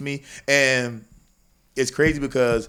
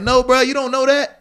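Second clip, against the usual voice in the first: louder and higher, bruh-come-on; exasperated raised voice; 10 semitones higher, 5 dB louder than usual. Retold or imitated. To a podcast guest who talks in absolutes, a loud exasperated retort. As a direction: push back hard, disbelieving. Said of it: "It's like".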